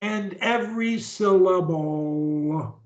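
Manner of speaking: "every syllable" stretched out, each syllable sounded; exaggerated pronunciation. Shown as a demonstration. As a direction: draw "syllable" out to a long last syllable. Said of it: "do the pronunciation the same way I do it".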